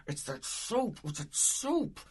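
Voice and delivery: high-pitched mock upset, climbing on the repeat; imitated unraveling. Friend retells Sandy second-guessing herself, high-pitched unraveling. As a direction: imitate someone coming unraveled, pitch rising. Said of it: "her unraveling is just brilliant".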